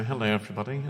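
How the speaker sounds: low, quiet mock-English greeting; English accent. Scottish comic greeting London in their own accent, imitated English. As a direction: put on a hushed, polite English accent. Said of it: "I'm doing your accent now".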